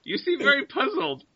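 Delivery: very high creature voice; bird creature. Acted. Bird leader remarks on confused adventurers, high creature voice.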